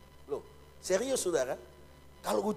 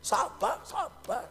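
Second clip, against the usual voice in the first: laughing through the words; 11 semitones higher, much slower than usual. Real, not acted. Preacher laughs at his own joke about patience, laughing. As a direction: giggle out the words.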